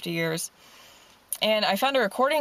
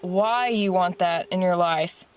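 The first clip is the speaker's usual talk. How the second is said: motivational closing speech. Acted. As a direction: encourage the listener like a coach, steady and sincere. Closing pep talk over music.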